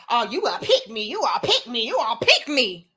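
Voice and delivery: singsong jeer of pick me, repeated; mocking taunt. Critics taunting her as a pick me, mocking singsong. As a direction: tease her the way the critics did. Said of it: "y'all that was talking about".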